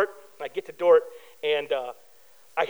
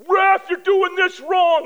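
high, slow, drawn-out heckle; imitated yelling; 6 semitones higher, 4 dB louder, slower than usual. Angry spectator at a basketball game, imitated yelling. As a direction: mimic a sports parent yelling at officials. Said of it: "this guy was yelling at the refs".

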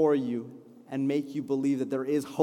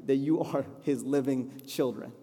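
ordinary talk, nothing marked

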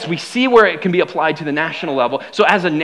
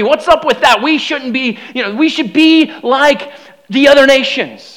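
higher, excited mock plea of the people; mock pleading crowd; 6 semitones higher, 4 dB louder than usual. Preacher voicing Israel asking Samuel for a king, mock pleading. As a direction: ham up the crowd begging for a king. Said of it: "and they say".

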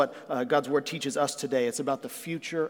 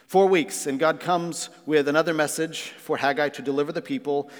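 ordinary delivery

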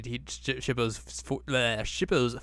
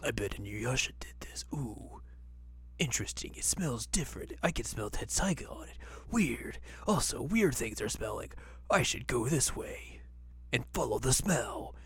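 hushed, quiet musing; whispering; 7 dB quieter than usual. Retold or imitated. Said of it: "Sesshomaru's like".

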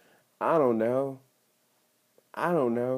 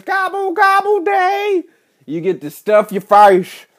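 goofy holiday voice; 13 dB louder, slower than usual. Acted. Silly voice for Thanksgiving.

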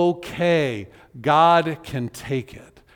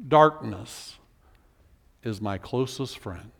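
"Darkness" low, then "is my closest friend" sinking much lower and softer; somber lament; 6 semitones lower, 4 dB quieter than usual. Acted. Preacher repeating the psalm's bleak last line, despairing. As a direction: let it fall away into despair. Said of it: "After all of that".

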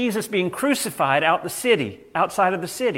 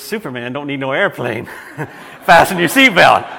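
stewardess laughing through her punchline, louder at the end; laughing comeback; 3 semitones lower, 9 dB louder than usual. Retold or imitated. Stewardess fires back at Muhammad Ali, laughing. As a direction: laugh out the comeback, then bark the order through it. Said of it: "she looks back and smiles and says".

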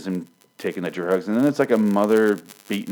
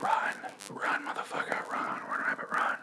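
hushed, urgent mock whisper; mimicked urgent whisper; 11 dB quieter than usual. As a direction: whisper it like secretly urging an escape. Character secretly urging Nick to flee.

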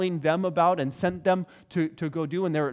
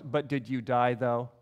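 low-pitched mock brush-off; dismissive; 7 semitones lower, 3 dB quieter than usual. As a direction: drop the pitch and wave the complaint away. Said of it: "it's this very dismissive".

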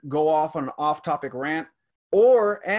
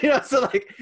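laughing; 8 semitones higher, 4 dB louder than usual. Real, not acted. Still laughing at the story.

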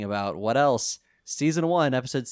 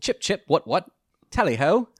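high, hammed-up posh British exclamations; over-the-top fake British accent; mock British accent; 8 semitones higher, 6 dB louder than usual. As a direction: play a cartoon Englishman. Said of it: "terrible accents".

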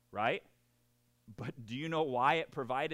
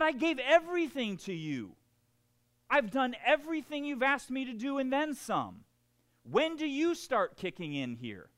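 reliving the rant, pleading and indignant; half-hearted mock yell at God; retold yelling at God; 8 semitones higher, 5 dB louder than usual. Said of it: "I was yelling at God".

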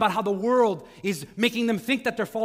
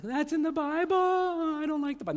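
higher, teasing wife voice; mock wife; 6 semitones higher, 3 dB quieter than usual. Pastor jokingly voicing his wife, higher. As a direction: put silly words in the wife's mouth, pitched up. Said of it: "They're like".

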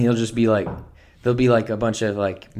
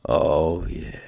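low moan; 10 semitones lower, 3 dB quieter than usual. Retold or imitated.

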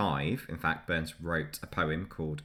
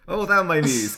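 a hammed-up Scottish bard; mock Scottish accent; 12 semitones higher, 10 dB louder than usual. Shown as a demonstration. Reading Burns to his co-host in a Scottish accent. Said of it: "attempting something that sounds a bit Scottish".

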